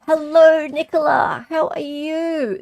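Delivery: high, loud, slow silly greeting voice; hammed-up sing-song hello, drawn out. Streamer greets a chat regular in a silly voice. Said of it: "The silly voices are the best part".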